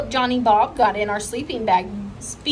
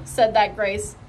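plain reading voice

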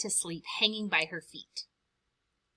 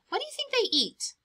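quick, much higher curious ask; playful question; faster than usual. Real, not acted. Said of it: "asks the listening child".

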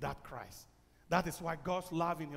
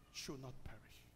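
sudden soft, whispered close; hushed whisper; 3 semitones lower, 14 dB quieter than usual. Acted. Preacher hushing the congregation into a soft whisper. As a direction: drop to a near whisper.

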